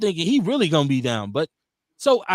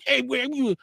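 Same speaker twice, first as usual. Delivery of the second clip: excited new dad; 9 semitones higher than usual. Retold or imitated. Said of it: "I was like".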